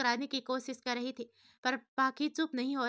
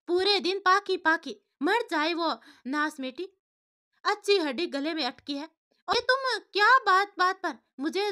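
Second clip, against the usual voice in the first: loud, higher, venomous outburst; spiteful angry wife; 5 semitones higher, 5 dB louder than usual. A narrator's reading. Wife screaming back at her husband, furious and spiteful. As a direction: spit the curses at him.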